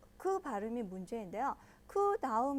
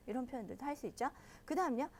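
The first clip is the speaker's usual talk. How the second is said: usual speaking voice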